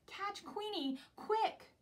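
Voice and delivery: high urgent duck cry, loud on quick; rally the others to give chase; urgent call. Acted. Dotty urging the animals to catch Queenie, high urgent shout.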